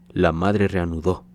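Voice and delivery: ordinary delivery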